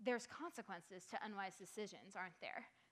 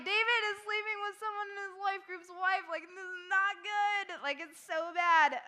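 exaggerated dismay; 8 semitones higher, 10 dB louder than usual. Stressing how bad David's sin is.

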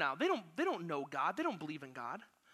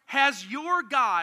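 loud anguished call to Daniel; anguished cry; 3 semitones higher, 8 dB louder, much slower than usual. Acted. King calls to Daniel at the den, loud and anguished. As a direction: cry his name out in anguish. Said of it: "he cried out in an anguished voice".

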